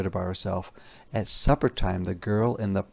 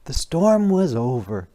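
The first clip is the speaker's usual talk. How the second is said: plain reading voice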